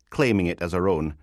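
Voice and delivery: plain reading voice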